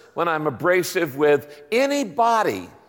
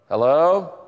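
hello with a rising, questioning tune; 4 dB louder than usual. Shown as a demonstration. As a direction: ask hello, sliding upward. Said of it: "all the ways you can say hello".

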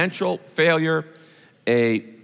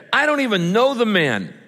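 raised, higher-pitched heated denial; swearing denial; 8 semitones higher, 3 dB louder than usual. A narrator's reading. Peter swears to bystanders he's no follower, heated denial. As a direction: deny it hotly, pitch jumping up. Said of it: "He swore an oath".

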